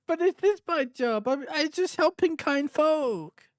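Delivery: high pleading captive; whiny bandit voice. Acted. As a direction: plead like a caught thief.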